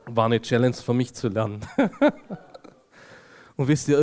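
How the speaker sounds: usual speaking voice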